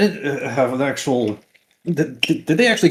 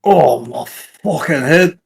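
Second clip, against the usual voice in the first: pained groan; 5 dB louder, much slower than usual. Acted. Character waking after a fall.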